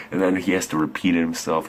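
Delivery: usual speaking voice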